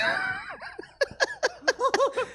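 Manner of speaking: very high, far-off screech; screeching cry. Pretend. Comedian's next impression, a high screech. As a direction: let out a wild shriek. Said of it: "this is".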